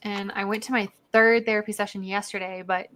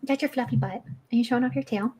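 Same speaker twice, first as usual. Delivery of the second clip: ordinary delivery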